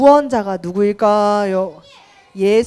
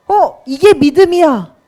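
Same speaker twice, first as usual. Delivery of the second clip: louder, higher mock declaration; mistaken assertion; 5 semitones higher, 7 dB louder than usual. Preacher voicing someone who mistakes faith, loud assertive mock declaration. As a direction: blurt it out as a confident misconception.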